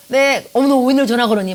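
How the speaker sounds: loud, high surprised gasp of a friend; surprised exclamation. Retold or imitated. Friend surprised by international call. (깜짝 놀라 크게 외치는 친구)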